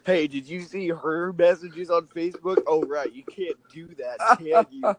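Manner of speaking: playful mock gossip-queen tone; snooping Zuckerberg. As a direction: tease like a nosy neighbor reading private messages. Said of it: "be like".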